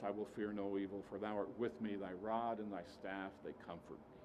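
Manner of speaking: hushed reverent Psalm 23; soft reverent recitation. Acted. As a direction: let it fall to a hush.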